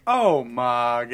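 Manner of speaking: loud, hammed-up sitcom catchphrase; eighties sitcom exasperation. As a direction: call it out like a sitcom punchline. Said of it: "like, an eighties sitcom now".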